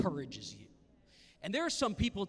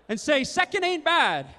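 crowd call-out; 10 semitones higher, 10 dB louder than usual. Shown as a demonstration. Preacher feeding the crowd a line to repeat, loud cheer. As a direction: belt it out for them to repeat.

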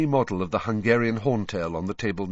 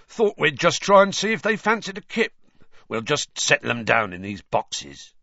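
a light gruff giant voice; Hagrid voice; 5 semitones higher, 4 dB louder than usual. Acted. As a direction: speak as the big friendly gamekeeper.

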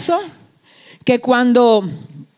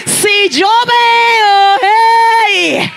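slow, drawn-out, high shouted cry; preaching cry; 8 semitones higher, 5 dB louder, much slower than usual. Real, not acted. Preacher crying out to the church, slow and loud. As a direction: cry it out at the peak of the sermon.

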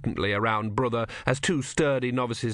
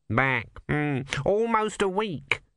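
lightly voiced nervous cleric, raised pitch; a fussy young monk, pitched up; high fussy monk; 5 semitones higher, slower than usual. A narrator's reading. Numrod voiced by narrator.

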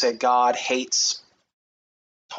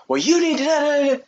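high mock rant, much higher and louder; 13 semitones higher, 3 dB louder than usual. Mocking angry Christians on the internet, high mock rant. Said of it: "They get angry and they start".

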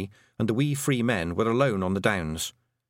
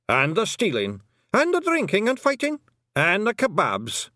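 high, excited little warriors chiming in; eager Feegles; 10 semitones higher, 4 dB louder than usual. Acted. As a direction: pipe up eagerly, pitch jumping high. Feegles all eagerly join in.

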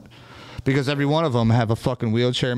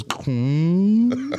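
imitated clunk; 6 semitones higher than usual. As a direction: make the dull clunk of a stuck ramp. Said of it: "the thing goes".

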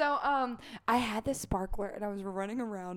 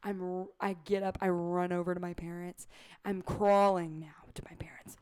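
ordinary delivery

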